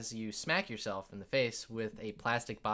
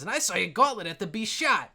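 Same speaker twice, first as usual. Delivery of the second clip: higher, louder, eager report; dwarf piping up; 7 semitones higher, 6 dB louder than usual. Acted. Another dwarf pipes up about lost armor, boisterous. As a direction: chime in boisterously.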